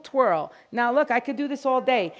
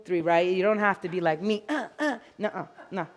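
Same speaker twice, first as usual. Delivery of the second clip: ordinary delivery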